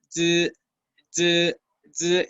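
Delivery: "zi" as a vibrating hum, almost a buzz; short, separate buzzed "zi" sounds. Shown as a demonstration. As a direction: let the vocal cords buzz on "zi". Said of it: "es casi como un zumbido".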